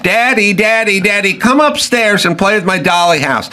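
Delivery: put-on high, begging child voice; mock child calling her daddy; little girl. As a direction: plead like a small girl wanting her dad. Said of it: "the little girl would come up".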